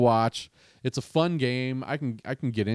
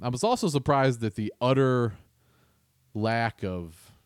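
ordinary talk

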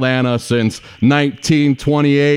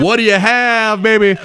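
pitched-up, hammed-up server's call; counter yell; 8 semitones higher than usual. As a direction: call out like a Varsity counter worker. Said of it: "they're gonna yell at you".

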